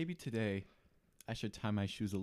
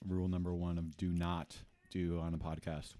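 normal talking voice

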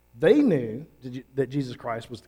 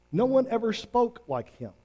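much higher and fuller, stressing one; a rising, insistent echo of wonder; emphatic awe; 8 semitones higher than usual. Retold or imitated. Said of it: "the officers simply answered this".

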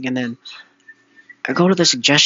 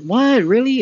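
high, louder burst of disbelief; surprised reaction; 11 semitones higher, 5 dB louder, slower than usual. Retold or imitated. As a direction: act out a taken-aback double take. Acted reaction, not mad, just surprised.

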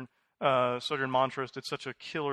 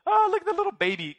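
put-on high, fast cooing baby talk; 8 dB louder, faster than usual. Pastor mimicking people cooing over a baby, high baby talk. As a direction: coo at a baby, high and quick. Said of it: "the cute fourteen-month-old".

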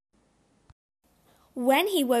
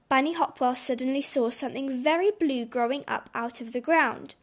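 plain reading voice

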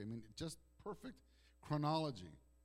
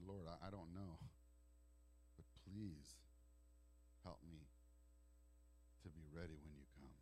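tearful prayer; 7 semitones lower, 11 dB quieter than usual. Retold or imitated. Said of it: "I started crying".

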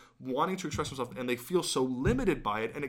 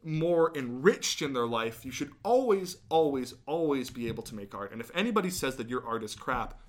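normal talking voice

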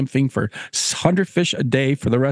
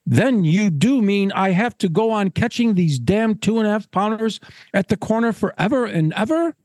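higher-pitched, exasperated gentleman; dismayed Caswell; 6 semitones higher than usual. Acted. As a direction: build the question in appalled disbelief. Caswell realises his fate.

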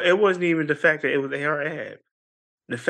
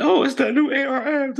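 high-pitched pleased recognition; retold perk-up, pitched way up; mock excited; 11 semitones higher than usual. Man hearing a new song on the radio, mock excited.

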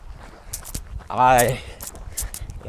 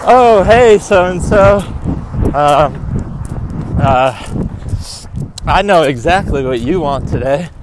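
waitress voice; 3 semitones higher than usual. Retold or imitated. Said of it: "the waitress is like".

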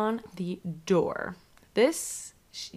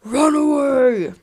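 slow, loud shouted warning; 6 semitones higher, 8 dB louder, slower than usual. Retold or imitated. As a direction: bellow it out slowly. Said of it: "a loud voice that goes".

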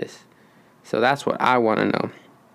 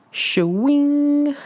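leering shwing. Pretend. Leering over Scarlett Johansson.

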